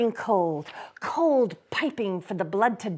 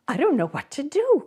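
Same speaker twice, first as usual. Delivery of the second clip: laughing and crying; 7 semitones higher, faster than usual. Acted. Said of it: "laughing and crying in the same breath".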